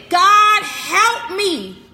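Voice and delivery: sudden loud call to God; retold cry for help. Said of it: "If I said".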